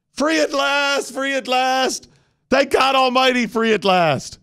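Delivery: high, ringing cry of deliverance; mock-solemn jubilant oratory; triumphant orator. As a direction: proclaim it like a rapturous preacher, hammed up. Hammed-up quote of famous oration.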